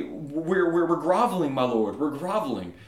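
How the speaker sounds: groveling subjects. Acted. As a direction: cower before your lord, hammed-up. Frightened men answer angry God.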